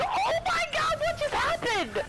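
very high startled squeal; shocked outburst. Real, not acted. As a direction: squeal it in real disbelief. Sudden shock in the game.

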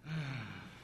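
long low sigh before the dull ask; bored sigh. Shown as a demonstration. Pastor showing children a bored sigh. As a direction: sigh like you can't be bothered. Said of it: "Do you think anybody would want to come".

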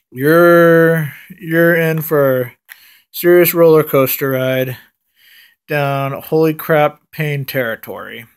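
ordinary delivery

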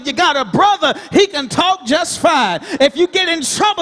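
ordinary delivery

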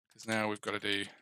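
ordinary talk

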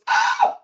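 sharp breathy "Ah!" of alarm; a startled gasp from the inner chimp; chimp's alarm. Retold or imitated. Inner chimp spotting shoes in the hall, gasping in alarm. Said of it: "The chimp goes".

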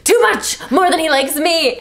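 high, loud swooning confession; hammed-up lovesick cry; dramatic gush.

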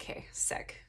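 very soft, whispered aside; hushed reply. Retold or imitated. Groggy patient answering the nurse, retold in a hush. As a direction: drop to a near whisper.

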